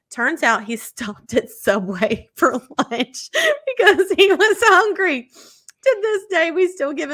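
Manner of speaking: high, loud, laughter breaking through; cracking up at the Subway twist; laughing while reading. Real, not acted. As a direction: read it through giggles.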